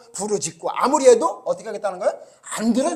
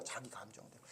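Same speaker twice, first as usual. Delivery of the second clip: whispered echo; 9 semitones lower, 28 dB quieter than usual. Real, not acted. Repeating phrase as whisper.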